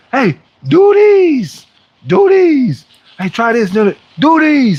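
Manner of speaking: drawn-out high hype call, very slow at first; catchphrase impression. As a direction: imitate the fitness guy's catchphrase, stretched high. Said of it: "the guy that always says".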